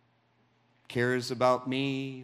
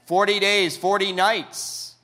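much higher and louder, slowed on forty nights; proclaimed; 8 semitones higher, 8 dB louder than usual. Acted. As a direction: proclaim the long journey. Preacher building drama.

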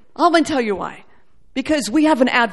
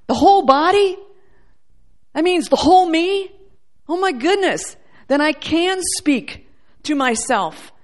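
mock amazement; 5 semitones higher, 3 dB louder than usual.

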